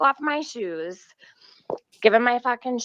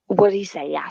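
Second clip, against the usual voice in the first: normal talking voice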